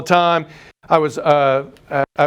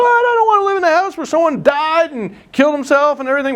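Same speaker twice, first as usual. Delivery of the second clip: high wailing mock cry, much higher; imitating crying wife; 11 semitones higher than usual. Buyer's wife crying over the suicide house, high mock wail. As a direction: play the upset wife, pitched way up. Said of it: "The wife is crying".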